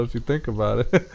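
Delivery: usual speaking voice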